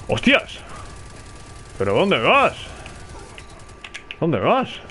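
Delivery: real startled outcry, pitch swooping up. Real, not acted. Gamer to a player who jumped in his face, startled. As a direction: blurt it out in disbelief, rising sharply at the end.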